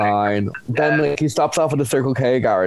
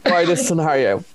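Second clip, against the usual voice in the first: frustrated retort; 8 semitones higher than usual. Retold or imitated. Acted frustrated reply to the date.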